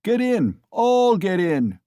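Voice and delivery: slow loud bellow; crying out. A narrator's reading. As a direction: roar the invitation. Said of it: "cried Mikolka".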